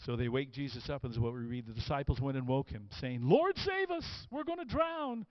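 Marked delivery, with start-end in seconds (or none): 3.2-5.3 high-pitched cry of alarm; panicked cry for help. Acted. Disciples waking Jesus in the storm, panicked cry. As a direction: call out as if about to drown.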